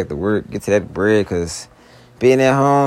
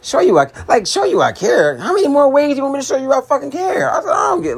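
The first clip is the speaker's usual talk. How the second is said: exasperated; 13 semitones higher than usual. Real, not acted. Ranting at his girlfriend in a voicemail, exasperated shout. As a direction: blow up in frustration.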